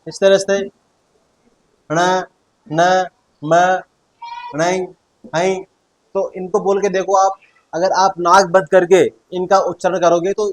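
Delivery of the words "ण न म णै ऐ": ण, न, म sounded out one by one through the nose; teacher sounding the nasal letters on their own; nasal consonant demo. Shown as a demonstration. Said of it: "आप इनका उच्चारण करके देख लीजिए".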